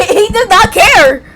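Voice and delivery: high, drawn-out protest through amusement; exasperated, playful retort; emphatic comeback. Real, not acted. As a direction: push back loudly and slowly. Comeback to listener's correction, laughing.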